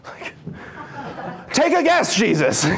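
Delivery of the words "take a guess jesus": laughing quip. Real, not acted. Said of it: "laughing aside to Jesus".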